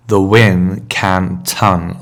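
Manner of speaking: the win can ton said slowly as separate words; split into words. Shown as a demonstration. Decoding the signpost, each word slow and apart. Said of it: "which I translated to mean".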